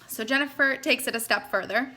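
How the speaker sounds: ordinary talk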